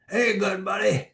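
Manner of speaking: high, far-off southern trucker drawl. Retold or imitated. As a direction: put on a Southern trucker twang. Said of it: "in a southern drawl".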